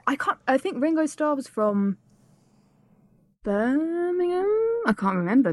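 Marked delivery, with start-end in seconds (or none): 3.4-4.4 slow, high, drawn-out Brummie attempt; bad Birmingham accent. Host guessing at [name]'s home town, bad Brummie accent. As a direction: stretch it out in a poor Birmingham accent. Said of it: "That's really bad".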